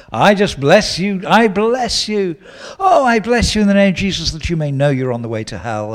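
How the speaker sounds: preachy blessing. Shown as a demonstration. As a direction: parody the preacher who threatens hell. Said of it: "Don't preach the gospel in the pretense of blessing them".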